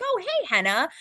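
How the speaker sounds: a light, high imitation of a casual hello back; friendly celebrity reply. Chris Evans greeting the anchor back, lightly imitated casual hello.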